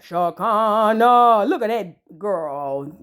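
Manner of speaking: slow, low, drawn-out mocking of Shakana; mocking drawl. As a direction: drag the name out slow and low in mockery. Mocking cast member by name.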